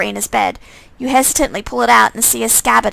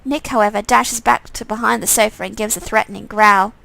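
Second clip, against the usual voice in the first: ordinary talk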